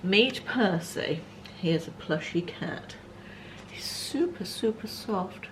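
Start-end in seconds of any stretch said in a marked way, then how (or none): 3.7-5.5 high, soft cooing over the toy. Real, not acted. Vlogger coos over the soft plush cat. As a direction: coo at a fluffy toy.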